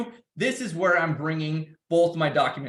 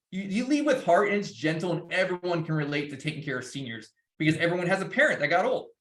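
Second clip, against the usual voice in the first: ordinary talk, nothing marked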